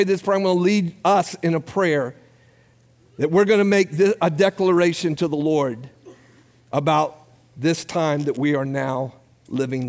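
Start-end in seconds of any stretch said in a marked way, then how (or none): none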